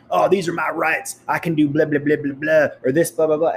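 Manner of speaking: sneering mock whine of rights talk; mocking entitled whiner. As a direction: parody the entitled complainer. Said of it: "Don't bitch about".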